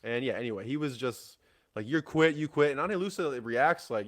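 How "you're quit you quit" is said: higher, louder retold jeer; mock yelled accusation. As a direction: imitate someone hurling an accusation. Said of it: "like, screaming at you".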